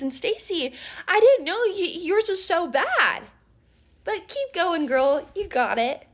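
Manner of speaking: high, laughing mock sweetness; mocking fake sweetness. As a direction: sneer through fake praise.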